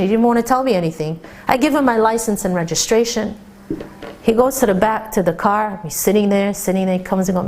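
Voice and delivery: normal talking voice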